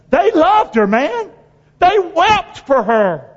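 fervent preaching. Real, not acted. Preacher describing the widows' love for Dorcas, fervent rise. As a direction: let the passion swell.

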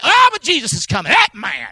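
high, loud whiny excuse; mock complacent believer. Preacher mocking lazy believers, hammed-up whiny excuse. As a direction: parody the smug excuse.

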